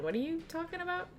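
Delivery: confused. Retold or imitated. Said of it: "At first I was like".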